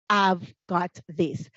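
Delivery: low, slow, measured self-assurance; confident. Acted. Teacher modelling what to tell your appraiser, quietly confident. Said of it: "you can confidently say that".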